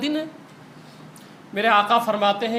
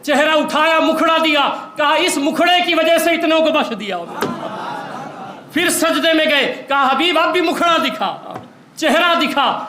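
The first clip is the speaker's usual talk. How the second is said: preaching at full force; 4 semitones higher than usual. Acted. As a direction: rise to a passionate peak for the congregation. Climax of the sermon. (جوش سے بھرپور خطابت)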